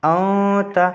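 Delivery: "औ ता" with the n held long in the nose; ikhfa nasal hold. Shown as a demonstration. Teacher shows students how to read it, ikhfa nasal hold. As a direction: hum the nasal through "औ" before releasing "ता". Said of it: "नून की आवाज़ को नाक में छुपा".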